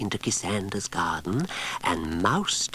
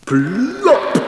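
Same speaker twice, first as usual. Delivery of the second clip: much higher, loud popped plop; 12 dB louder than usual. A narrator's reading. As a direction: burst out the plop. Second pudding falls.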